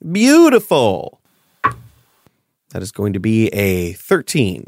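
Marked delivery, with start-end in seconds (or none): 0.1-1.2 high, loud, slow relish; gleeful. Real, not acted. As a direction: savour the moment. GM gloats at the failed save.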